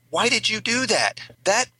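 high-pitched exasperated passenger, scolding; shrill disbelief at the driver's apology; upset passenger. Retold or imitated. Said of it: "they were like this".

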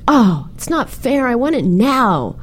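put-on disappointed little boy. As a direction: imitate a kid protesting to his mom. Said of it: "he looked really disappointed".

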